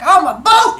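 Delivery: put-on high, loud playful kid voice; silly kid. Teacher voices Ricardo rolling his chair, high loud silly kid. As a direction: announce it like a giddy child. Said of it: "he's like".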